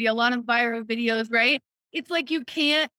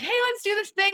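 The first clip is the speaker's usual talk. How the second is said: higher, bright imitation of friends egging you on; eager friends; 9 semitones higher than usual. Friends urging you to join in, mock eager invitation. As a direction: toss it out like a keen friend. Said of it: "they're like".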